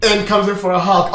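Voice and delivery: normal talking voice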